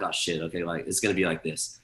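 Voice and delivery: low, soft muttered realization; deflated, under-the-breath acceptance; resigned aside. Retold or imitated. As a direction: drop the voice as it sinks in. Resigned aside after being overruled.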